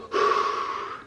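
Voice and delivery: voiceless breath blown out; heavy puff of air; long exhaled sigh. Real, not acted. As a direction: let out a calming breath. Calming down after the comments.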